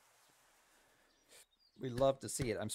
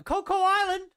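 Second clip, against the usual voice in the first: very high, loud shout of excitement; excited shout; 9 dB louder, slower than usual. Real, not acted. Streamer thrilled to hear of Coco Island, shouting. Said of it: "I'm shouting a lot today".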